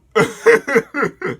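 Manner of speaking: laughing at his own fumble; high laugh. Real, not acted. Laughing at his own stumble over the character's name. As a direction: giggle jumping way up.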